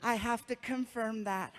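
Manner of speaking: soft, lightly sweet nurse; a gushing fan nurse, lightly voiced. Nurse gushing to a famous patient, lightly voiced.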